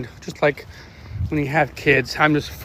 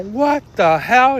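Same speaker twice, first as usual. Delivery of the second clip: slow, louder, higher mock disbelief; retold surprise; 6 semitones higher, 5 dB louder, much slower than usual. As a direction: replay his own astonished reaction. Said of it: "I was all".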